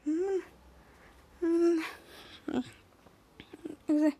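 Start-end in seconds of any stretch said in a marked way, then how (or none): none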